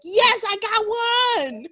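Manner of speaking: high, loud victorious cry; triumphant gloat. Retold or imitated. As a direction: crow over winning the point. Winning a trivia point over husband.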